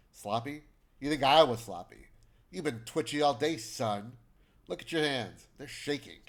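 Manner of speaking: loud, accusing comeback; indignant retort. Acted. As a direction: hit back at the accusation. Accused character angrily hits back.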